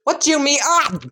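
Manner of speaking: high, startled cry; alarmed yelp. Acted. Iida's legs are being turned into ducks, alarmed yelp. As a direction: shoot up high in cartoon panic.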